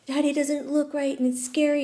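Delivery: put-on a frightened little boy; scared child. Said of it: "and he's screaming, and he's crying".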